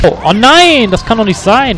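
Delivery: high, loud disbelieving cry; loud dismay. Real, not acted. Gamer cries out in disbelief at a failure. Fails the game.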